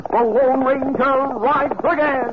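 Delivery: high, slow, ringing proclamation of the title; rousing announcer call. Acted. Announcer to the audience, proclaiming the hero's return, high and slow. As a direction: build it like the big opening line of a radio serial. Announcer's show-opening climax.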